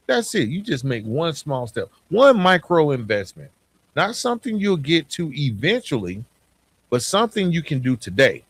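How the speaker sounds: normal talking voice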